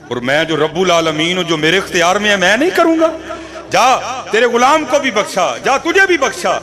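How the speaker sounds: high, loud, triumphant decree; booming divine proclamation. Acted. Voice from the dream proclaiming pardon, loud and triumphant. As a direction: proclaim forgiveness with full force.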